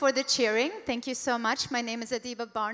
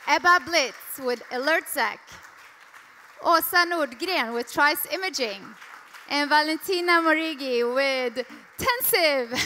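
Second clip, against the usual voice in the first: loud, higher announcer-style name call over the applause; 4 semitones higher, 9 dB louder than usual. Real, not acted. Host calling panelists up to the crowd, loud announcer call. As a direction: call each name out to bring them on stage.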